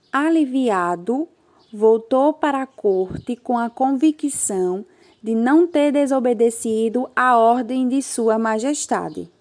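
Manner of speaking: put-on lively read-aloud for children; story reading. Teacher reading a story to her class, lively read-aloud.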